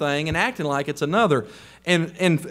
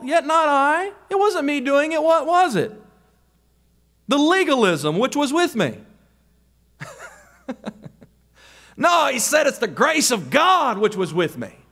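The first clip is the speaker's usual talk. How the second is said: raised, insistent pulpit voice; preaching at full force; 8 semitones higher than usual. Acted. Preacher scolding lazy believers, fervent and raised. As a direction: drive it home to the congregation.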